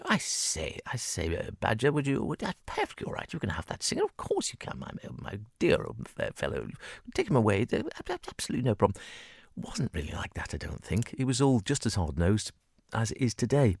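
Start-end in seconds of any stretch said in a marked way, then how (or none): 0.1-9.0 lightly voiced plummy old-school toff, softer; a hint of a genteel bandleader, soft and airy; posh gentleman. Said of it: "they would say".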